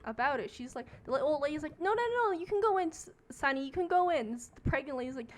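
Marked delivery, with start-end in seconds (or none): 1.8-4.3 put-on high, loud old lady insisting; hammed-up urgent waving-in, pitched high; retold insistence.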